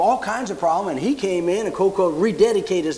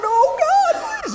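very high, loud mock wail; hammed-up tearful cry; retold hard crying; 4 dB louder, slower than usual. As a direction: imitate a desperate sobbing plea. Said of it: "she's really crying".

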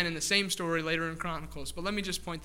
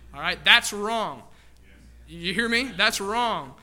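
loud, slow, firm insistence; emphatic; 6 dB louder, slower than usual. Real, not acted. Pastor warns the church about dancing, loud and firm. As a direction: lean hard on that's wrong. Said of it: "preacher insists, repeats for emphasis".

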